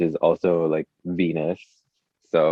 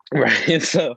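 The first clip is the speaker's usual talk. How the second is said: laughing; 9 semitones higher, 4 dB louder than usual. Real, not acted. After laughing at own joke.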